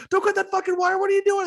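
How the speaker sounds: frantic, very high-pitched scolding; imitated yelling. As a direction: yell in alarm like a manic game character. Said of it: "He's fucking yelling at you".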